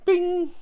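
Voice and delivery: high, loud, held ding; imitated notification chime. Dating app message arrives, imitated chime. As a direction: sing out a single high ping.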